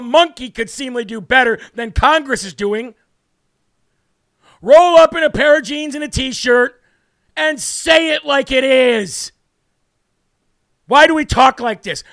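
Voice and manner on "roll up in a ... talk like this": loud rant. Real, not acted. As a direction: hammer it home. Ranting at Congress.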